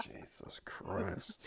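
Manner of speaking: breathy whisper, very slow. Real, not acted. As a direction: sigh it out under your breath. Despairing aside.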